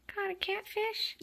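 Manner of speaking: very high, slow cooing; pet talk. Real, not acted. Kitten caught on the toy, high slow pet talk.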